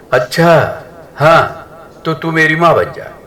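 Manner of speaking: mock her daughter-in-law with a pout; sulky sarcasm. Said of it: "सास मुंह बनाते हुए".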